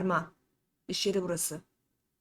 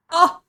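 startled cry; 8 semitones higher, 18 dB louder than usual. Acted. Werewolf attack, sudden cry.

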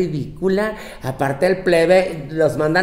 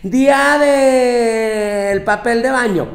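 mock announcement; 7 semitones higher, 5 dB louder, much slower than usual. Mocking people who celebrate everything.

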